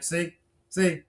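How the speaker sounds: ordinary talk, nothing marked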